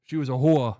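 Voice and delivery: loud mock mobster Italian-American line; hammed-up Italian-American, punched loud on the last word; mock Italian-American accent. Mimicking a Sopranos line.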